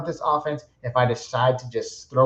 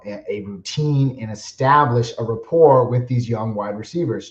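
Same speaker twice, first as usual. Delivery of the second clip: normal talking voice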